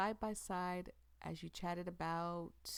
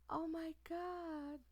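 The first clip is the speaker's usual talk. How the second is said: slow shocked gasp; 9 semitones higher, slower than usual. Real, not acted. Reacting to her confession.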